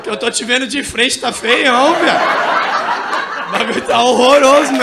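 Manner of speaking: loud, hammed-up wail of complaint; exaggerated tearful protest; mock crying. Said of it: "meu reflexo chorando falou".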